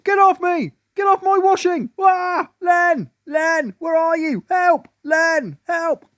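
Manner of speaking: very high, loud frantic calls for Len; panicked cries. A narrator's reading. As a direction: scream for help as bugs attack. Woman panicking, calling for help.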